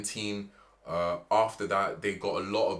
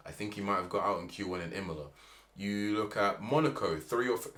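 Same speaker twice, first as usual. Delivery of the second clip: ordinary delivery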